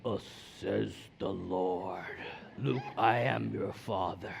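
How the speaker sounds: very low, slow movie-villain voice; hushed, drawn-out mock voice of God; booming-Lord parody. Son guessing at the Lord's voice, deep slow parody. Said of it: "or does he sound like".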